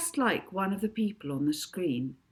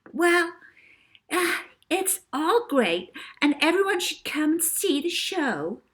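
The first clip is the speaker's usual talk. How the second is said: put-on sugar-sweet child star; 9 semitones higher, 4 dB louder than usual. A narrator's reading. Davina answering the host.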